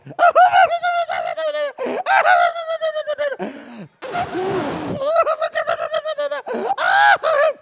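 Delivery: high hammed-up bawling; loud exaggerated wailing. Lady crying alone in the hospital lounge, exaggerated wailing. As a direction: wail in long high sobbing bursts. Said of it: "and she is crying".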